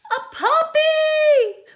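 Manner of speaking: high, loud, slow cry of joy; excited squeal. Acted. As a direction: burst out with delight. Pigeon blurts its wish.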